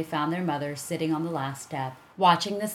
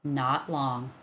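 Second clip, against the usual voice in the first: plain reading voice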